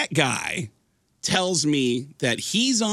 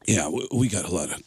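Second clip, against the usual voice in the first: tattoo shop guy; 6 semitones lower than usual. Retold or imitated. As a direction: drop down into a shrugging shop guy.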